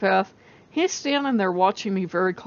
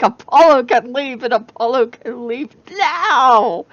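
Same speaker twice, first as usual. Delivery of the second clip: high, loud, slow furious demand; retold angry outburst; 4 semitones higher, 6 dB louder than usual. Ranting at Loki about Apollo, loud, slow and furious. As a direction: drag out the demand in a fury. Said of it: "I'm like".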